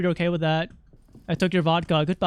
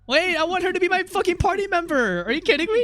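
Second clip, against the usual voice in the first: much higher, gleeful outburst; excited; 9 semitones higher than usual. Real, not acted. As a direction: gush about the new party member. Thrilled at new party member.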